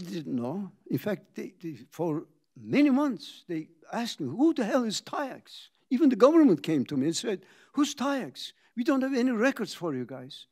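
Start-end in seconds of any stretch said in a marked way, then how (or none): 4.3-5.7 higher-pitched mock bafflement; retold indignant question, pitched up; baffled demand. Acting out baffled customers' question. 7.7-10.5 higher-pitched mock indignation; retold baffled objection, pitched up; official challenge. Acting out government's baffled challenge.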